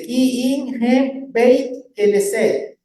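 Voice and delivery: "Ki in hem beit Knesset" read out slowly, syllable by syllable; Hebrew pronunciation. Shown as a demonstration. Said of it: "donde yo le puse la pronunciación".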